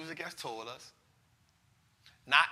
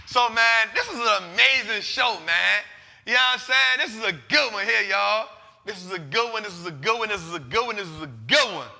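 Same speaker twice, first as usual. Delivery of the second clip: hyped; 3 semitones higher, 8 dB louder than usual. Real, not acted. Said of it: "hyping the show to viewers".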